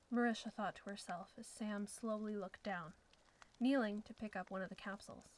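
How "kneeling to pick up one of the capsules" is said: plain reading voice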